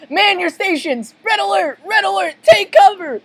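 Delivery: high, frantic warning shouts; panicked red-alert yelling; urgent alarm. Acted. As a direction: raise the alarm at top pitch. SpongeBob sounds the alarm.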